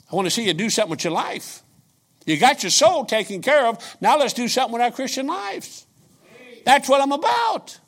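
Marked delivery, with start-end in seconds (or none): none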